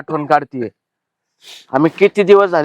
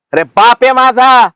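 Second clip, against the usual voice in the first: loud, higher, drawn-out retort; indignant declaration; 5 semitones higher, 5 dB louder, slower than usual. Acted. Son tells farmer whose father this is, indignant. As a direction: assert it forcefully, slowly.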